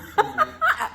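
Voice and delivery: a sudden high burst of laughter. Real, not acted. Laughing at Erica's joke, high cackle.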